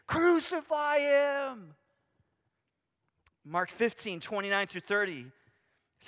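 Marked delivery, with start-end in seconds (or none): 0.1-1.7 higher, slow, drawn-out angry cry; mock crowd scream. Crowd yelling at Jesus, slow mock scream. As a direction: stretch it out like the raging crowd. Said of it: "they're, they're screaming".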